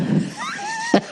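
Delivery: squeaky high-pitched giggle; a sudden high titter. Real, not acted. Laugh after odds joke.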